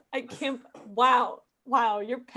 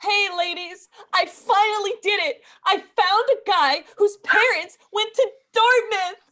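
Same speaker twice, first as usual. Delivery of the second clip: put-on high, excited news for the girls; gushing with big news; excited announcement; 6 semitones higher, 4 dB louder than usual. Announcing to girls group chat.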